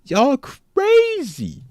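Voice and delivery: y'all crazy stretched out, high and very slow; mock disbelief. Real, not acted. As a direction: drawl crazy out long in a high voice. Repeats phrase stretched high.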